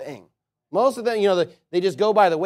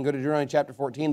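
quick softer restatement of Go to Deuteronomy chapter fourteen; fast repeat; 5 semitones lower, 5 dB quieter, much faster than usual. Shown as a demonstration.

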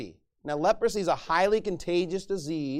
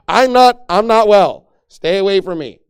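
loud, urgent warning; acted warning; 15 dB louder than usual. Sick person warning others away, loud and urgent. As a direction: shout it to keep them back.

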